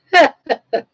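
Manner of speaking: sudden high loud laugh; loud burst of laughter. Real, not acted.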